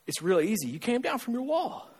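soft, simple deadpan verdict; understated answer. Retold or imitated. Pastor answering a baffled couple, soft and understated. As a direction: drop to a quiet reply after the wail.

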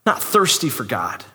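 usual speaking voice

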